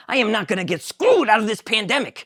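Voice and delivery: high, loud George with laughs; excited George. Acted. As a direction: realize you're free. George perks up.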